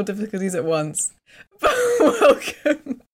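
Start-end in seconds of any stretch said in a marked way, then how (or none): 1.6-3.0 slow welcome through laughter; laughing. Real, not acted.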